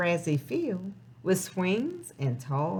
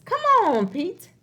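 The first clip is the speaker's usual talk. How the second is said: higher and louder, a cheery teacher calling Pete; 8 semitones higher, 4 dB louder than usual. Acted. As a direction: call him along like a bright schoolteacher. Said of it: "when his teacher says".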